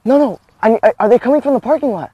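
high, loud, urgent question; pretend panic. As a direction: act hunted, urgently checking for pursuers. Fake escapee fears pursuers.

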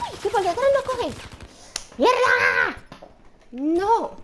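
high, loud, fast frantic cries; loud frantic yells of defeat; frantic outburst. Real, not acted. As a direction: lose it as you die. Getting killed in firefight.

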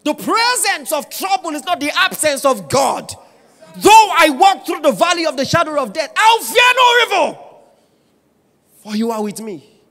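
high, loud, half-sung preaching; sing-shout the psalm over the music; preaching at full force. Real, not acted. Pastor lifting the congregation with the psalm, shouting.

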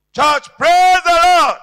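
slow, loud, high "praise the Lord"; preacher's call. Acted. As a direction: call out to the church like a pastor. Preacher calls congregation.

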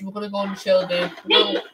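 normal talking voice

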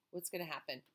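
plain reading voice

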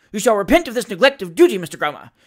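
loud, high magistrate's tirade; irate rebuke from the bench; furious. A narrator's reading. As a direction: hand down threats and accusations angrily. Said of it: "said the furious magistrate".

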